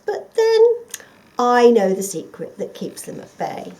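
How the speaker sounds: a fox sweet-talking the dog; sly fox. Acted.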